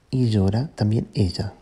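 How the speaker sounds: ordinary delivery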